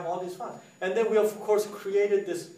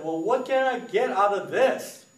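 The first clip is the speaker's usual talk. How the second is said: retold demand; 5 semitones higher, 6 dB louder than usual.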